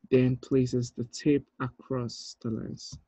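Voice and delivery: ordinary talk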